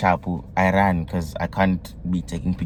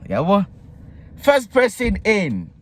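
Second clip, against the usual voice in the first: excited; 13 semitones higher than usual. Real, not acted. Excited build-up to name call.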